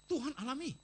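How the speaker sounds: ordinary delivery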